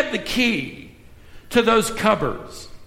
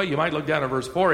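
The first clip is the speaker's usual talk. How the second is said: ordinary talk, nothing marked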